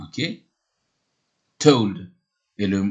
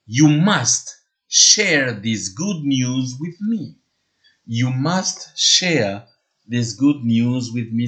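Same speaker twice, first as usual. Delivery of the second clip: you must share said very slowly; slow bonus phrase; slower than usual. Shown as a demonstration. Teacher dictating the bonus phrase slowly to students. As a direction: drag out each word for learners. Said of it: "la phrase bonus".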